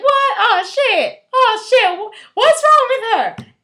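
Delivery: loud, high, laughing panic; panicked shouting. Retold or imitated. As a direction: yell in laughing alarm. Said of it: "They're like".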